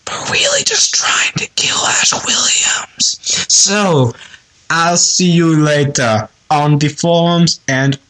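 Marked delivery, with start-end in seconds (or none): 0.1-3.2 secret whispered aside. Acted. Caller on voicemail whispering the real plan. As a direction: whisper it like a conspiracy. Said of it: "whispered aside in voicemail".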